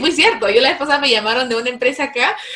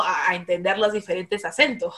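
normal talking voice

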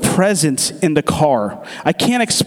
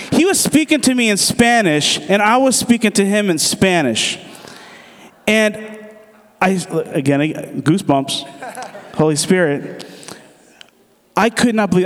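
normal talking voice

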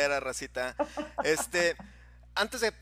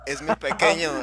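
emphatic; 10 dB louder than usual. Real, not acted. Insisting on My Little Nemo.

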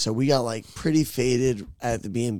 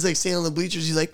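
ordinary talk, nothing marked

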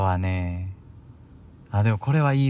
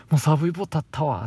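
Kansai dialect quote; 5 semitones higher, faster than usual. Shown as a demonstration. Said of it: "って言うんですけど".

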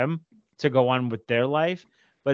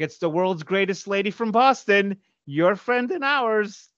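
higher, slow mock announcer; snarky radio dedication; 7 semitones higher, slower than usual. Son writing a snarky dedication for his mom, hammed up. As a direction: ham it up like a radio host. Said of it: "I'm like".

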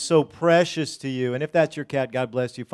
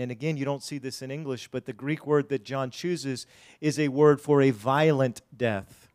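usual speaking voice

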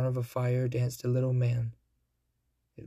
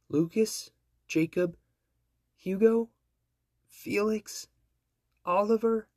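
higher and higher, hopeful; each name pitched up, eager; rising hopeful guesses; 9 semitones higher than usual. Acted. Queen guessing names, rising.

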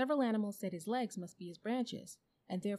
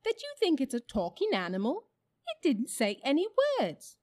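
high, loud bird voice; incredulous jackdaw; 7 semitones higher, 10 dB louder than usual. A narrator's reading. Jackdaw disputes with the badger, loudly incredulous. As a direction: challenge it loudly, pitched well up.

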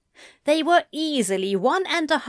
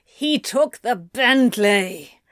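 growled; 4 dB louder, slower than usual. A narrator's reading. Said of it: "Anthony growled".

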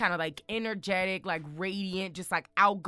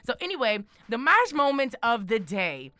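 usual speaking voice

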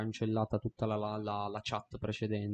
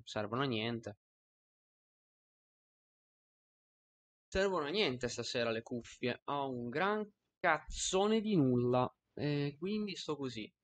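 far from mic. Real, not acted. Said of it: "mi avvicino al microfono".

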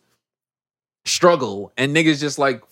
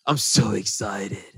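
very slow, low and soft mock Edward; emo excitement; 6 semitones lower, 4 dB quieter, much slower than usual. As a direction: drag it out flat as a joke. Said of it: "his excitement is hilarious because it's also emo".

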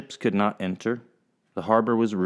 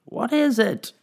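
high, pressing question; lady impatient with the captain; 3 dB louder than usual. Acted. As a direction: demand it now. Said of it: "said the lady impatiently".

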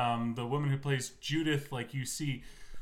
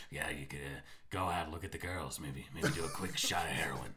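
much lower, softer, laid-back voice; Don DeMillo impression; 11 semitones lower, 7 dB quieter than usual. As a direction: drop low and easy like a sleazy host. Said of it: "a Don DeMillo sketch from Comedy Bang Bang".

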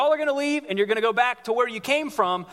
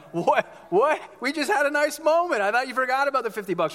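surprised protest; 4 semitones higher than usual. Retold or imitated. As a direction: act out being caught off guard, higher pitch. Listener caught out by a demand.

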